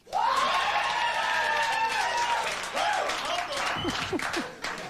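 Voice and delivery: shrill laughing whoop; high shrieking laugh. Real, not acted. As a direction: burst into screeching laughter. After [name] remark.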